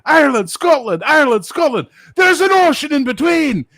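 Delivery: high, loud furious shout; hammed-up Scottish rage, shouted high; furious Scot. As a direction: scream him out of the store. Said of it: "He's like".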